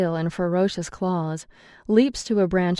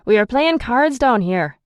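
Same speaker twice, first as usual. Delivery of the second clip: loud, higher call from below; 5 semitones higher, 6 dB louder than usual. A narrator's reading. Ian shouting up about the card game, loud. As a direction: call it up the stairs, annoyed. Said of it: "Ian calls from downstairs".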